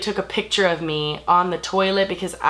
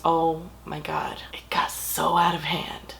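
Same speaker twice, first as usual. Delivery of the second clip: hushed; 3 semitones lower, 6 dB quieter, slower than usual. Real, not acted. Confiding the party got out of hand.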